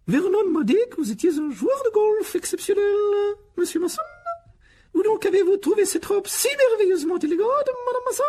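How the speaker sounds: very high, loud affected flattery; simpering aunt. Acted. Aunt rehearsing compliments for the guests, gushing and simpering. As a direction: gush in a piercing society voice.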